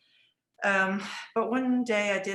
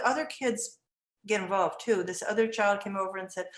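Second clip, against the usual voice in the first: ordinary delivery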